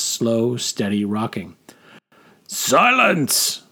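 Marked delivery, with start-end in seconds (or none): 2.5-3.6 commanding shout, pitched high; battleground bellow. Acted. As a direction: roar it like an officer across a battlefield. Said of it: "bellowed in a battleground shout".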